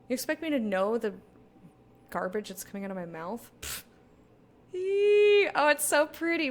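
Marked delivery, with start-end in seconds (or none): 4.7-6.5 excited squeal. Real, not acted. Squealing at pretty thing.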